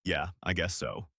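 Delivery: low grudging mumble; mumbled, unwilling. Shown as a demonstration. Coach demonstrates an unwilling reply to a caller, mumbled. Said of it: "versus a mumbled".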